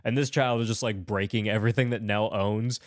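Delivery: usual speaking voice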